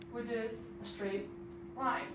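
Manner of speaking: ordinary talk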